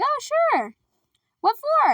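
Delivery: very high-pitched naive voice; Alice. Retold or imitated. Alice answers a donation request, high put-on obliging voice. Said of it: "And Alice is like".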